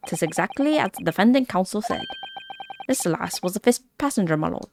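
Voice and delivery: Irish brogue telling a tale; Irish accent. Acted. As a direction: spin it like an Irish yarn. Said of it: "I need to summon my Irish accent".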